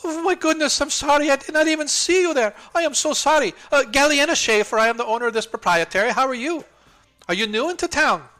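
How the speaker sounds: high, loud, chirpy shopkeeper calling her son; fussy shopkeeper woman. Acted. Shopkeeper greets newcomers, calling her son in a high voice. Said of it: "she looks down and goes".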